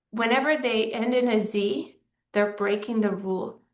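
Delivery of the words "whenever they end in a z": ordinary delivery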